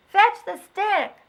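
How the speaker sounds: high, loud, slow command; children shouting. Acted. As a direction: holler at the dog. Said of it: "they shouted".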